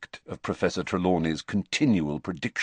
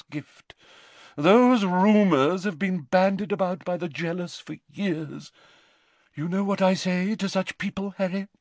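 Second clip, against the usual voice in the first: lofty, mystical lady seer, higher; an eccentric old prophetess recounting; dreamy seer; 11 semitones higher than usual. A narrator's reading. Trelawney voice.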